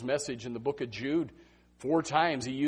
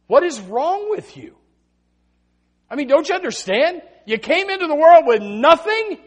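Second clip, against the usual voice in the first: loud, high, exasperated Job; Job scolding; 15 dB louder than usual. Acted. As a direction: scold them as Job would.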